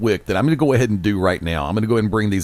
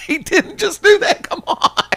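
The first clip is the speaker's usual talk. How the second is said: laughing disbelief; slower than usual. Real, not acted.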